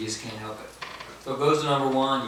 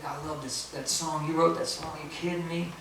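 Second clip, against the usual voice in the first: hushed disbelief, lifting higher toward the end; starstruck gushing; 4 semitones higher, 6 dB quieter than usual. Retold or imitated. Fan coming up to the songwriter, gushing in disbelief. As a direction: imitate an amazed fan, softly.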